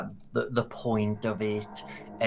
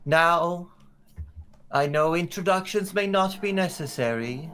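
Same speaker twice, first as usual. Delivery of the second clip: regal woman; 7 semitones higher, 3 dB louder than usual. Acted. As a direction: greet the crowd grandly, with long pauses. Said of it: "this regal-looking woman".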